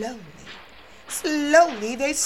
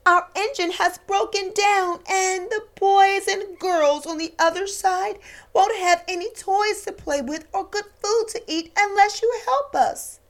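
higher, louder begging toys; pleading toys; 5 semitones higher, 3 dB louder than usual. Acted. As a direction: plead with the old engine. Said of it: "cried all the dolls and toys together".

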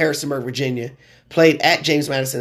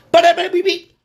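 punch sound effect; 4 dB louder than usual. Retold or imitated. Imitating the knockout punches.

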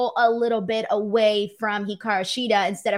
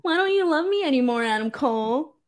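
mock whiny crying; 4 semitones higher than usual. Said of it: "crying and being like".

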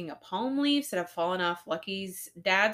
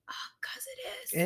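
whispered aside; 10 dB quieter than usual. Real, not acted. Aside to the listener.

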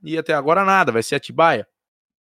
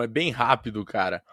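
ordinary talk, nothing marked

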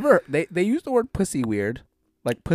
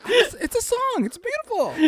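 much higher mock voice of Jen pleading; imitating Jen. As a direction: squeak it like his girlfriend defending the song. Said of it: "she's like".

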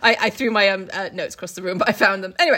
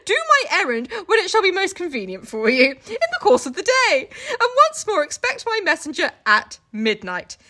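much higher, hammy frantic reading; mock frantic Jekyll, higher and louder; panicked Jekyll; 8 semitones higher, 4 dB louder than usual. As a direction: read it like a frantic, needy letter writer.

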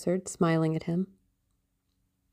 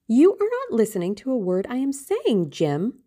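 put-on higher, girlish protest from Sybil; exclaimed reproach in a raised pitch; crying out in complaint; 6 semitones higher, 3 dB louder than usual. A narrator's reading. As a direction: complain in a higher voice, dropping on the name. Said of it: "cried Sybil".

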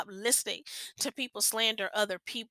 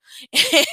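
short, loud, higher-pitched laugh; quick amused burst of laughter; 6 semitones higher, 10 dB louder than usual. Real, not acted. Laughs at nosy people remark.

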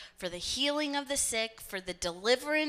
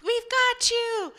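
very high, strained call from a helicopter; hammed-up rescue shout, pitched way up; shouting rescuers; 12 semitones higher, 3 dB louder than usual. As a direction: shout down to someone far below. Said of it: "they're shouting at him".

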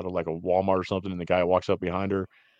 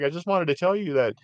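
mock guy; 7 semitones higher than usual. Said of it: "he's like".

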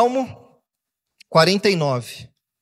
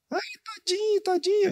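mock pitying voice; 14 semitones higher, 7 dB quieter than usual. Adult cooing over a hurt child, mock pitying. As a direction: coo it like an overprotective parent.

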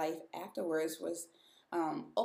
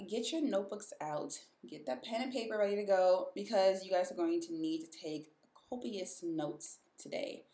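normal talking voice

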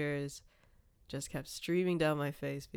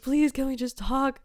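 imitating tearful pleading, higher and louder; retold pleading; 9 semitones higher, 6 dB louder than usual. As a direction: beg through the door, re-enacted. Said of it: "I was like".

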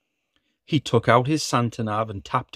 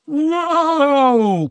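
high, loud startled whoa; startled cry; 6 dB louder than usual. Acted. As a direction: cry out in surprise. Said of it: "cried Santa".

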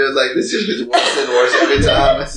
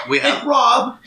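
voice cracking; slower than usual. Real, not acted. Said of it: "My voice is just cracked like a little girl".

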